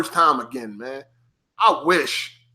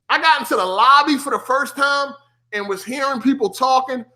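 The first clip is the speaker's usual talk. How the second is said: ordinary delivery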